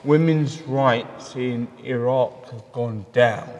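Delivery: normal talking voice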